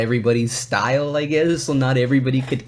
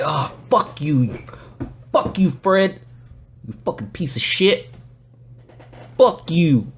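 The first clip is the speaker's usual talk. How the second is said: higher, halting pretend anger; hammed-up exasperated swearing; mock cursing back; 6 semitones higher, slower than usual. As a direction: curse them out in broken bursts. Acts out cursing back hecklers.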